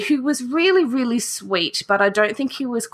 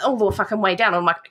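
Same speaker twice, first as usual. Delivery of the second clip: ordinary talk, nothing marked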